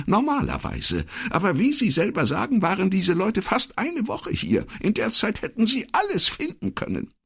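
very high, hurried secretary voice; worried secretary. Acted. Voices Elettra in dialogue.

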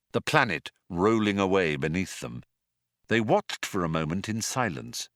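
usual speaking voice

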